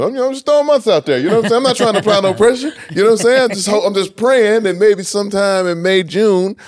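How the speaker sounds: high, loud and eager; excited hype. Real, not acted. Podcast host hoping for new [name] music, excited hype. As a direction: pump up the hope for a new album.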